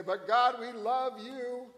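sweetly pleading innocence; mock pious plea. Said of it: "we come in here and say".

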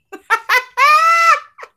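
high, loud shriek of a laugh. Real, not acted. Watching Lucky help, high shrieking laugh. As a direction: squeal with laughter.

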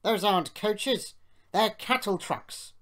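haughty engine's outrage; snorting scorn. A narrator's reading. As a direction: pour contempt on them. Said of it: "he snorted".

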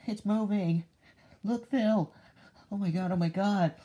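high, whimpering panic; panicked. Acted. Calling to Phil as the creature moves, panicked. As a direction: gasp it out in fright.

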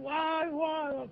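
high, drawn-out imitated wail; thin high mock cries of woe. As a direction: imitate distant screams without raising volume. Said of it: "צועקים".

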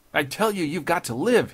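high, loud insistence; crying out. A narrator's reading. Gunman stopping the judge's suicide, loud insistent cry. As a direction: shout it down at him forcefully. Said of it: "he cried".

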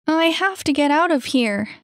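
high, loud and agitated; urgent insistence. Acted. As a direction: insist on getting away. Annie desperate to leave.

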